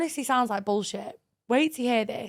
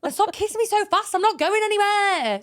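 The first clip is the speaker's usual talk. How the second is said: raised, exasperated telling-off; exasperated complaint; 7 semitones higher, 5 dB louder than usual. Retold or imitated. To a boyfriend kissing too fast, exasperated raised voice. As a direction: scold a too-eager kisser.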